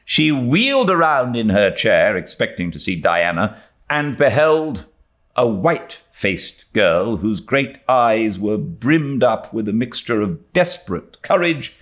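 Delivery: ordinary delivery, nothing marked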